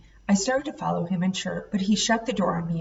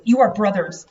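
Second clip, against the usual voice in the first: ordinary delivery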